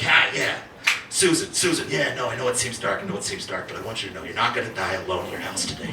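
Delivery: low, soft and reassuring; soothing hotline voice. Acted. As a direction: drop it low and hushed, calming a distressed caller. Acting Bundy soothing a caller.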